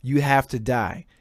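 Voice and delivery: ordinary talk, nothing marked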